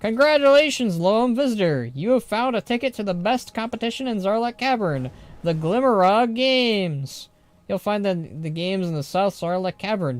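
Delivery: showy congratulatory announcer; announcer-style read. Acted. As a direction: read it like a cheery contest announcement. Reads an in-game invitation aloud.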